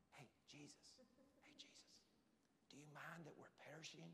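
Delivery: comically timid whisper; mock whisper. Shown as a demonstration. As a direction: whisper as if not to disturb him. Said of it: "I don't think they went back there".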